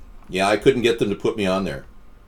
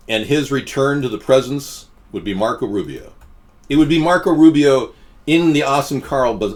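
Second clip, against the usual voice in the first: usual speaking voice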